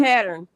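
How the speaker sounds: Pattern said with a full, strong final R; country pattern. Shown as a demonstration. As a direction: lean on the ending of Pattern. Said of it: "My country accent".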